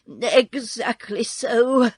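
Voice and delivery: slow, drawn-out agreement; pleased. A narrator's reading. Little man cheerfully agreeing he is exposed, pleased. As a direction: agree slowly, with a touch of relish. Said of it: "as if it pleased him".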